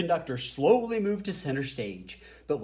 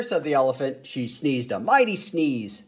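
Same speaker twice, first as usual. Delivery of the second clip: ordinary delivery, nothing marked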